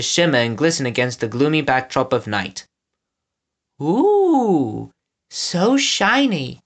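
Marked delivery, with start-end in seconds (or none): 3.8-6.6 high, slow, dazzled and amazed. A narrator's reading. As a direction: gasp at something sparkly. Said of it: "dazzled by the light".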